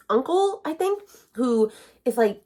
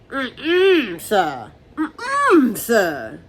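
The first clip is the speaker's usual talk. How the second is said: sassy refusal; 3 semitones higher, 4 dB louder, much slower than usual. Pretend. Reader sassily rejecting Peter's rude comment, mock offended. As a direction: shut him down with attitude.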